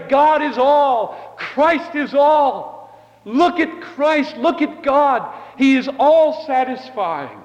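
high, slow, ringing proclamation of God; fervent preaching. Acted. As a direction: lift every word up to the back row. Preaching at full force.

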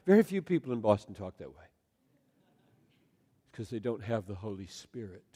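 ordinary talk

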